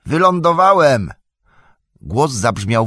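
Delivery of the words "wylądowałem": joyful shout from below. A narrator's reading. Sam shouting up to Frodo that he landed safely, loud. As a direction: call it up the cliff, loud and high. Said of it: "krzyknął".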